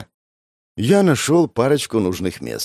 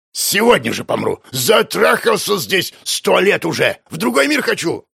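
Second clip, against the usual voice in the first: put-on cranky old man; 10 semitones higher than usual. Old man eager to die.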